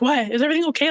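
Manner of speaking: a sudden high, alarmed question; panicked. Retold or imitated. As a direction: ask it in a rush of fear. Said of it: "I was panicked".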